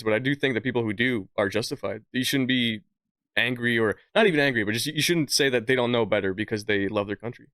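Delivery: usual speaking voice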